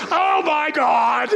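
slow, drawn-out groan of dismay; Peter's dismay. Retold or imitated. Peter hears there is no wine, slow groaning dismay. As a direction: stretch it like a tired disciple. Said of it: "You can just hear Peter go".

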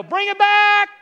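loud, high revival shout; booming motivational speech. Retold or imitated. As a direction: make the whole room shout it. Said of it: "I'd make everybody stand up and go".

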